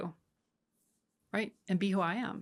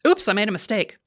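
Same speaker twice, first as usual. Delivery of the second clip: husband blurting out; 10 dB louder than usual. Retold or imitated. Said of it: "he goes".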